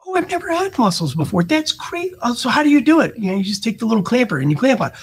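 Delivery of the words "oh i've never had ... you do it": high, breathless delight at a new dish; gushing dinner guest. Retold or imitated. Guest amazed by mussels at dinner, high gushing voice. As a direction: play the wowed guest, pitch way up. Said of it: "what are the people gonna ask you?".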